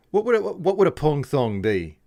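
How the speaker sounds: put-on posh British accent. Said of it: "come off as a little bit like an Aussie instead".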